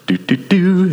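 light little duck ditty; carefree humming. Acted. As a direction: float away without a care. Mimics duck drifting off carefree.

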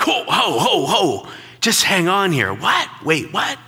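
startled "ho, ho" breaking into the quarrel; startled interruption. Retold or imitated. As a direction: jump in to stop the argument, half-shouted. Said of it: "I was like".

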